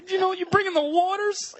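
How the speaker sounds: high, wavering mock-emotional plea; mock welling up; fake tearful gush. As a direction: ham up the choked-up praise.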